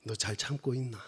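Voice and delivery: hushed Busan dialect question; very low, soft Gyeongsang speech. Retold or imitated. God asks the preacher if he is enduring, hushed Gyeongsang dialect. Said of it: "경상도 사투리로 하면".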